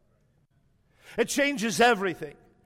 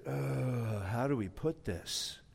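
low, hushed and reluctant; hesitant murmur; 9 semitones lower, 9 dB quieter, slower than usual. Acted. Disciples stuck for an answer to Jesus, hesitant. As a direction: stall under your breath, much lower.

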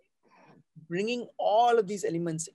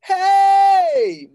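long, loud, high "hey"; mixed voice; 15 dB louder than usual. Shown as a demonstration. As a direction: call out "hey" high and sustained. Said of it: "mixed voice".

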